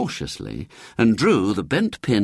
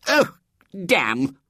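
higher, pained outburst; startled curse; 10 semitones higher than usual. A narrator's reading. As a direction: curse as the shoe hits you. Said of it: "said the vicar".

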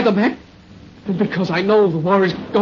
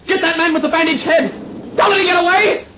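shouting; 7 semitones higher than usual. Acted. Sudden panicked order.

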